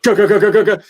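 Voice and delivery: rapid, high, loud staccato repeats; machine-gun snare imitation. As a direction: fire it off like a stuttering drum machine. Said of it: "it would be like".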